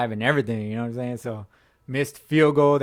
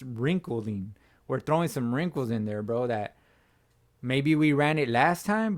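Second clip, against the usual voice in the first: ordinary delivery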